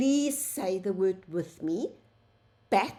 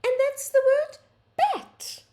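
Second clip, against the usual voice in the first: much higher and louder, celebrating; excited reveal; 6 dB louder than usual. Acted. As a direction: announce it with delight. Teacher reveals the word.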